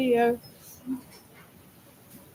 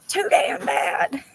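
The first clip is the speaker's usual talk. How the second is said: 1 grandpa voice; 5 semitones higher, much slower than usual. Retold or imitated. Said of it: "It's like".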